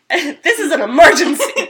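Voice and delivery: loud, high mock alarm; mock emergency. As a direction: exclaim it as a joke emergency. Jokingly reverses the retold non-emergency call.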